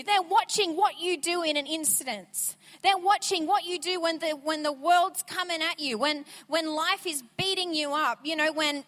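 normal talking voice

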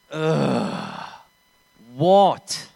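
imitating a huffy teen, loud on the word; groan, then throw it back like a bratty kid; arrogant teenager. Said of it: "Like an arrogant, pimply, thirteen to fourteen-year-old".

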